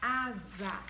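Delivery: asa with a voiced z between the vowels; asa with z sound. Shown as a demonstration. Teacher showing pupils the z sound in asa. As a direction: say asa with a buzzing z. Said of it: "olhe bem".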